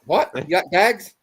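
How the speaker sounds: hammed-up quote from Snatch; imitated Snatch character. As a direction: mimic a hard-to-understand film accent, punched up. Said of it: "Was it Brad Pitt in that movie".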